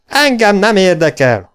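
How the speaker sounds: high, loud shout; bellowed refusal. A narrator's reading. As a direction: yell it back defiantly. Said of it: "ordított Gunnar".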